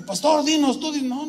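itching to act; eager bodyguards. Retold or imitated. Ushers eager, pastor calming. (guardaespaldas ansiosos, más agudos y fuertes)

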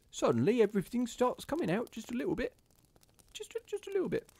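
high, squeaky mock-Cockney like a bad musical; hammed-up Cockney. Shown as a demonstration. Reader showing how the accent creeps in, exaggerated high Cockney. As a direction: let a cartoonish Cockney take over, pitch shooting up. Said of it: "that kind of Cockney accent".

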